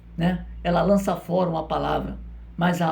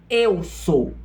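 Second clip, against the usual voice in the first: emphatic, spaced-out declaration; Jesus's declaration. Retold or imitated. Preacher showing how Jesus speaks, firm deliberate declaration. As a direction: land each word separately and firmly. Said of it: "Olha como ele diz".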